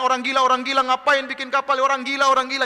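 high, loud repeated taunt; mock him like a heckling crowd; jeering. The crowd keeps calling Noah a madman, jeering. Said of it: "mereka ngejek-ngejek Nabi Nuh".

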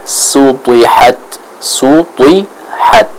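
slow sutihat with heavy, emphatic T; slow model recitation, thick T in sutihat. Shown as a demonstration. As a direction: stretch sutihat syllable by syllable, T full as "twi". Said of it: "harus dibaca dengan tebal".

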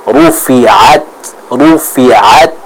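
"rufiat" with a firm throat ain; correct ain. Shown as a demonstration. Teacher models Quran recitation, correct ain sound.

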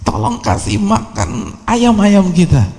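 high, slow mock yell; retold motherly yell. Mother sends her son to feed chickens, high mock yell. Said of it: "Teriak tuh ibunya".

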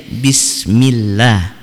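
BISMILLAH with a light l and open "a"; thin lam. Shown as a demonstration. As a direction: recite bismillah with a thin "llah". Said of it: "maka dibaca tipis lafadz Allahnya".

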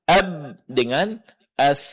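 "Ab" stopped short, "as" held, slow; slow contrast of stopped and flowing; ba stopped versus tha flowing. Shown as a demonstration. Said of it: "Begitu juga huruf ba".

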